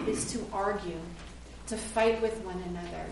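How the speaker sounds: ordinary talk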